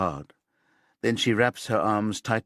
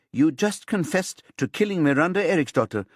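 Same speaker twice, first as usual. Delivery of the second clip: higher and pushing, raising the voice; louder voice; 7 semitones higher than usual. A narrator's reading. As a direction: press her, louder and higher. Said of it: "Susanne says in a louder voice".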